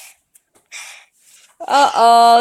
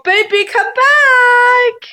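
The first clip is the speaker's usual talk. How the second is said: higher, slow sing-song call; 7 semitones higher, slower than usual. Real, not acted. Mom calls to the baby.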